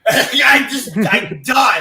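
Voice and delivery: loud laughing outburst. Real, not acted. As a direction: crack up as you say it. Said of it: "laughing at the scene".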